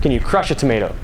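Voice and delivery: ordinary delivery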